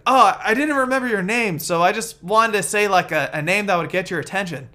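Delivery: high, loud goofy character voice; man's answer. Retold or imitated. Man explaining himself to the woman, high loud goofy voice. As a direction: blurt the excuse, high and loud. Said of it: "He's like".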